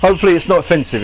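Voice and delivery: cheeky put-on London accent; American playfully faking a London accent. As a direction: chat in an amateur London accent. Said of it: "It's just a bit cheeky fun".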